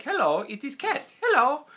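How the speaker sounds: high, loud cat voice. Shown as a demonstration. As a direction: greet as a silly cat. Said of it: "I had one voice for a cat".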